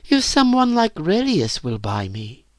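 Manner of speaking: put-on high, wondering girl's voice; wistful girl. A narrator's reading. Virginia wonders aloud about a buyer, wistful. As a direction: muse in a high girlish pitch.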